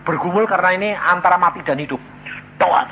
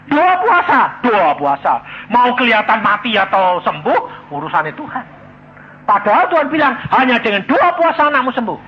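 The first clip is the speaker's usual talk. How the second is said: loud, higher preaching push; forceful preaching; 5 semitones higher, 7 dB louder than usual. Acted. Preacher loudly drives home prayer and fasting. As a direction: hammer the point home to the crowd. Preacher pressing his point.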